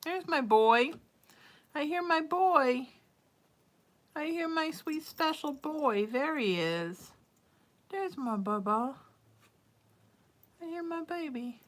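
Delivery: high, slow cooing to a pet. Real, not acted. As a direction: coo up high at your sweet cat. Cooing to her cat.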